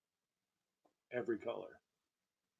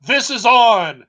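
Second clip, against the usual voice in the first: loud slow; 10 semitones higher, 19 dB louder, much slower than usual. Pretend.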